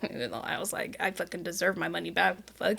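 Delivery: very quiet, low, quick mutter; muttered aside. Real, not acted. Client undercutting her polite thanks to friend, muttered aside. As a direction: drop it under your breath, fast.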